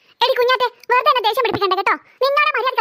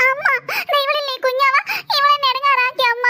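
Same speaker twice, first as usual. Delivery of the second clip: whining cry; 2 semitones higher than usual. Acted. Child tells mother on her sister, wailing. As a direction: wail the complaint to mother. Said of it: "എന്തിനാടി നീ ഇങ്ങനെ മോങ്ങണത്".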